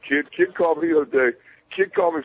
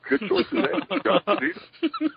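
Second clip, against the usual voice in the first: high, laughing mock praise; 12 semitones higher, slower than usual. Joking to himself about the name, high and laughing. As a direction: chuckle out a cheeky compliment.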